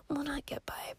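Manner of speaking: whispered self-correction under the breath; hushed whispered correction; whispered aside. Real, not acted. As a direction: drop to a whisper to correct yourself.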